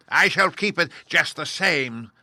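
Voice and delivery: high, loud, slow witch taunt; mocking witch. A narrator's reading. Witch mocking Dorothy, high and slow. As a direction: gloat over the child. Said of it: "laughing at her".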